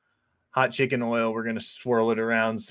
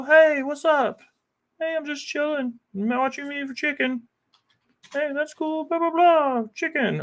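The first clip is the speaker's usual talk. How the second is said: much higher cartoon squeak; squeaky silly voice; 14 semitones higher than usual. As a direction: play a goofy little sidekick. Goofy pretend dialogue, high voice.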